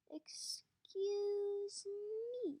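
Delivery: drawn out like a warning; icy interruption. Acted. Warden cold interruption.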